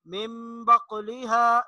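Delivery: मिम बकुलिहा slow with nasal म; slow recitation. Shown as a demonstration. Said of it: "मीम से तब्दील करके पढ़ेंगे". (मिम बकुलिहा गुन्ना से)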